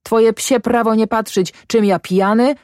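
high, heated retort, quickening; angry yell. A narrator's reading. Drunk farmer puts farmhand in his place, heated. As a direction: keep pitch up and press on angrily. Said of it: "wrzasnął Ślimak".

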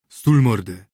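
loud rough snarl; growled order. A narrator's reading. Drinking companion snarling at another to shut up, loud. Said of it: "warknął drugi".

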